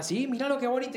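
high-pitched, lightly acted enthusiasm; eager proud dad. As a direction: imitate a parent eagerly showing it off, pitch up. Retold proud dad showing the song. (papá orgulloso imitado, tono agudo)

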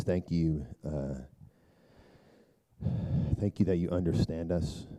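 low, soft praying voice; quiet prayer. Real, not acted. Pastor leads listeners into confession and prayer, quiet prayer. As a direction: pray softly and low, with pauses.

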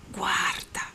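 urgent whisper; hushed warning. A narrator's reading. The friar points out the dying around them, whispered. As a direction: breathe it out as a dire warning.

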